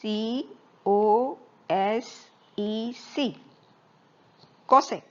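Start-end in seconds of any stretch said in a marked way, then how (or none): 0.0-3.4 very slow, letter-by-letter spelling. Shown as a demonstration. Teacher spelling the first five letters of cosecant, spelled out slowly. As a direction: spell it slowly with a gap after each letter. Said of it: "પાંચ અક્ષર લઈએ".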